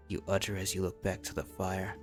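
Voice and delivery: plain reading voice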